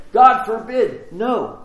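loud, drawn-out refusal, high in pitch; emphatic protest. Acted. Peter objecting to what Jesus foretells, emphatic protest.

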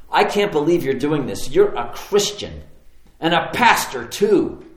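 loud, indignant quote, slowing on the punches; mock outrage with heavy stresses; retold angry accusation. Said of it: "he's obviously very angry".

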